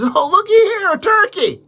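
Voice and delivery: high, loud feigned surprise; mock surprise. Little Johnny fakes surprise to the game warden, high and loud. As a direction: play innocent, as if just spotting it.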